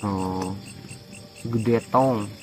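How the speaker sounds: ordinary talk, nothing marked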